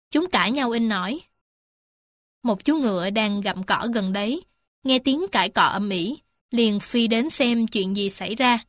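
usual speaking voice

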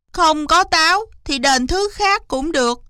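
high, quick-to-bargain fox voice; high-pitched scheming fox; eager fox. Acted. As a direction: jump in eagerly with the offer. Scheming fox seizes its chance.

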